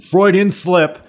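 high-pitched, laughing aside; amused laughing. Real, not acted. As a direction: laugh at your own slip. Laughs at own slip of tongue.

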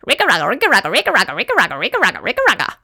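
rhythmic rigga-rugga machine noise, pitch swooping up and down; seesawing engine-like chugging; imitated pipe noise. A narrator's reading. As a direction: chug like a noisy sucking pipe. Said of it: "making a noise like this".

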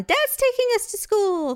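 high, bright announcement of Dad driving; proud kid announcing. Retold or imitated. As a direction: daughter proudly tells everyone Dad's taking them, announcing it high. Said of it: "Olivia had a voice to everyone".

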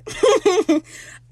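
high, loud burst of laughter; real high-pitched laugh. Real, not acted. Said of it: "I laughed".

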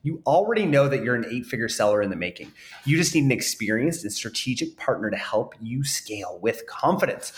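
calm scripted advert delivery; ad read. Acted. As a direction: sell it like a podcast ad spot. Host reads sponsor ad.

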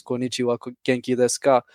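Konnichiwa, genki desu ka said with no English accent; native Japanese. Shown as a demonstration. Guest demos Japanese greeting with no accent. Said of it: "in Japanese".

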